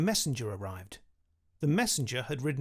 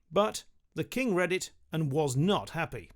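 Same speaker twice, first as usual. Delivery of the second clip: ordinary delivery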